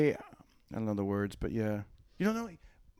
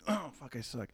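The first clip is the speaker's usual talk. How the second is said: usual speaking voice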